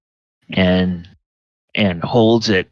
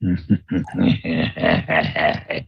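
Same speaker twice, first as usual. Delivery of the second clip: wheezing hyena cackle; hyena giggle; 4 semitones lower, 4 dB quieter than usual. Acted. Gnoll laughs at the note.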